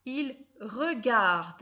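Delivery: Il regarde stretched out slowly; slow dictation. Shown as a demonstration. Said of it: "qu'est-ce qu'il fait?". (dicter « Il regarde » très lentement)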